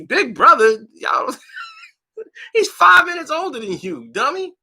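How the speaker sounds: a hammed-up high mocking jeer; mocking disbelief. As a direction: laugh at him scornfully.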